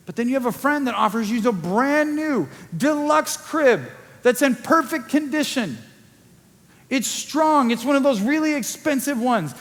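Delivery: usual speaking voice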